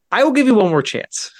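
high-pitched, firm last warning; re-enacted insistent warning; retold ultimatum. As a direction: lay down terms to a pest.

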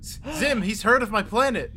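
high, eager rush of hope; light up at news of home; excited. Acted.

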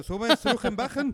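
put-on German accent, loud and high, laughing; Spanish with a hammed-up German accent. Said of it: "nomás con acento alemán".

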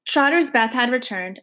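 ordinary delivery, nothing marked

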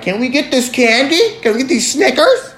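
put-on high pleading little-kid voice; mock child begging for candy; whiny kid. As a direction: beg like a small child at the checkout. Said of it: "I'm the kid that's like".

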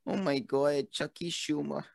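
put-on old New Yorker accent. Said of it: "what's the impression of Chuck Schumer".

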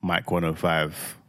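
very low, soft late-night DJ voice; radio host. Pretend. Husband showing off praised voice, deep radio-host tone. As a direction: drop deep into a mock radio ident.

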